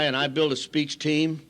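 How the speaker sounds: usual speaking voice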